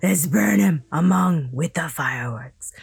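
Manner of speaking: loud, low, rough command; harsh villain's voice, loud and low; hoarse order. Acted. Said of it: "the tamer's horse voice ordered".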